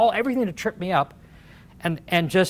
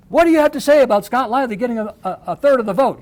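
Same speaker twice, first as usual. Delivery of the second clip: quick, louder, higher reporter question; pushy reporters; 5 semitones higher, 6 dB louder, faster than usual. Retold or imitated. Media cornering the governor, fast pushy question. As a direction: fire it off like a pack of reporters.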